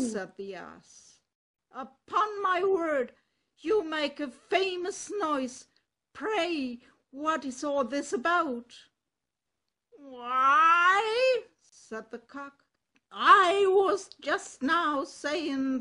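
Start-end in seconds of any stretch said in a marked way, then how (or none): 1.7-8.8 loud, bold donkey voice; a confident ass calling out to a friend; hearty donkey. Acted. Ass speaks to the cock. 10.0-11.4 high, loud, slow rooster voice; a cock complaining about his mistress; indignant rooster. Acted. Cock character speaks.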